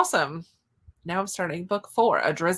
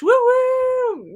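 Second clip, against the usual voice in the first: much higher, loud celebratory "woo woo"; excited cheer; 6 dB louder than usual. Real, not acted. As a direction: cheer them on like a fan. Cheering another finished book.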